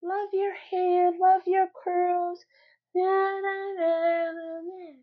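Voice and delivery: high, slow, mocking sing-song; mock sing-song slogan. Vlogger mocking the old natural hair slogan, high sing-song. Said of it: "before it was".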